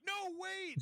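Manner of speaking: high, loud desperate cry; panicked plea. Retold or imitated. Kid pleading with the terrorist not to shoot, panicked. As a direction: beg for your life.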